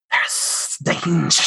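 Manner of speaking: slow hissing spider whisper. Acted. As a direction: breathe it out slowly like a spider. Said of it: "Just whisper".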